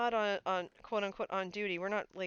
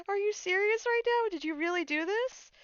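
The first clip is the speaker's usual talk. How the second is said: high, loud, giddy questions; excited disbelief; 10 semitones higher, 4 dB louder than usual. Retold or imitated. Fan asking streamer about mod role, giddy high disbelief. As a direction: gush in happy disbelief.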